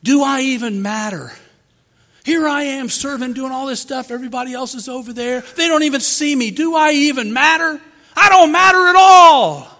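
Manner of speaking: a preacher voicing a wounded worker, building to a wail; self-pitying lament. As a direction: rise into despair on the last line. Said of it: "destructive thought".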